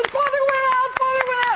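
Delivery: very high, hammed-up cheering; clapping crowd's excitement. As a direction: imitate an overjoyed crowd announcing news. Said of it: "they all start clapping".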